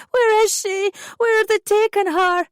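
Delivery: very high, loud panicked shout. A narrator's reading. Mother as staff rush her baby away, panicked shout. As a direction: cry out in panic. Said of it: "I shouted".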